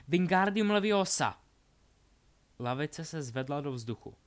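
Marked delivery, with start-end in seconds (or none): 0.1-1.4 loud, high incantation; shouted spell. Acted. Delphi lifts the bench to attack, shouted spell. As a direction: cast it at full voice. Villain casts levitation spell in duel.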